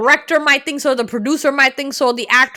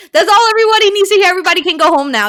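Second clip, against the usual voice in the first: high, loud, rapid-fire crowing; triumphant outburst; 8 semitones higher, 6 dB louder, much faster than usual. Real, not acted. Co-host crowing over his accent proof, triumphant outburst. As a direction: burst out fast and loud, case closed.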